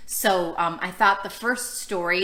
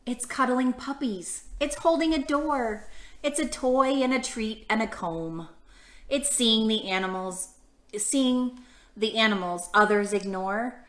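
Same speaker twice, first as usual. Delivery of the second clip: lilting read-aloud for little listeners; reading to children. Acted. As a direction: read each picture-book line gently to the kids. Reading picture book to kids.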